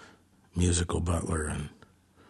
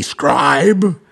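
loud, higher retold holler; insistent yell; 7 semitones higher, 10 dB louder than usual. Bandmate pointing at the musician, insistent imitated yell. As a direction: aim the shout straight at one person. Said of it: "he'd say it again, and now pointing at me".

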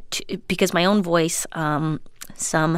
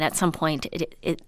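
ordinary talk

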